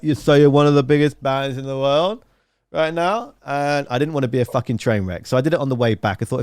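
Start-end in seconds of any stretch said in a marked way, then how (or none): 0.0-3.3 mock-dazed interviewer, voice rising into the question; stoned interviewer. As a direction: play a baked interviewer's wondering question. Imagines turning up stoned to interview.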